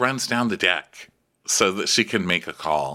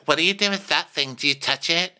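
mock lady guest; 9 semitones higher than usual. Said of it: "one of the ladies is like".

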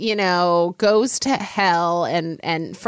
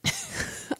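whispered giggle; 13 dB quieter than usual. Real, not acted. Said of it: "I have to whisper it".

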